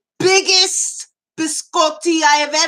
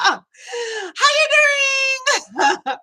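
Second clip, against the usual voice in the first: high, drawn-out, slow cry of hello; over-the-top greeting; 10 semitones higher, much slower than usual. Real, not acted. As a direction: greet them like a long-lost friend. Dramatic greeting after laugh.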